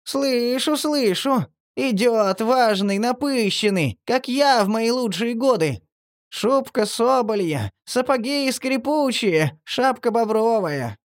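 very high, slow squeaky relish; high creaky ghoul voice. A narrator's reading. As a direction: savour it, squeaking. Marley's high creature voice.

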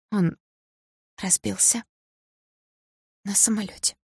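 high, halting, tearful voice; hoarse with tears. A narrator's reading. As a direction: bring it out in broken pieces, choked by crying. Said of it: "хриплым от слёз голосом".

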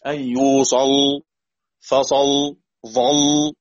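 "يوصل", "فصل", "ظل" each ending on a held, thick l; heavy lam at a stop. Shown as a demonstration. Teacher demonstrates tafkhim when pausing, heavy held lam. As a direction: stop on a dark, silent-vowelled l in "يوصل", "فصل", "ظل". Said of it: "سأفخم".

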